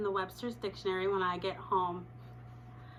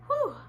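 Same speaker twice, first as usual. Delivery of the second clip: relieved whew; 4 dB louder than usual. Retold or imitated. Relief after smooth class.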